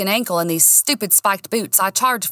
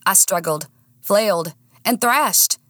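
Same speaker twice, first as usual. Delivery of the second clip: ordinary delivery, nothing marked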